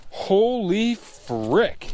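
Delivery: awed. Real, not acted. Overjoyed, drawn out.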